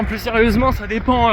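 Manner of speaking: usual speaking voice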